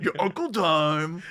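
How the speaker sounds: hammed-up wrestler impression; Macho Man. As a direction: stretch it out like a wrestling promo. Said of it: "I think it's Macho Man, actually".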